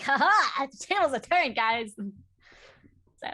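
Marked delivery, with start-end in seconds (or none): 0.0-1.9 mock gloat. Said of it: "be like".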